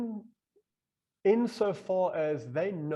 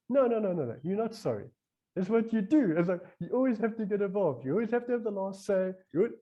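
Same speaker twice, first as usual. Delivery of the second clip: higher-pitched insistent telling-off; mock maternal rebuke, raised voice; scolding retort; 7 semitones higher than usual. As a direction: scold like a reproving mother, pitched up. Inner mother-like voice scolds him.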